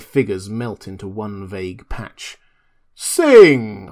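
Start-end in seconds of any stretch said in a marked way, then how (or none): 3.0-3.9 loud one-word shout. A narrator's reading. Montgomery shouting at the beast people to sing, loud. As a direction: bellow it to the group. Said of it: "I heard Montgomery shout".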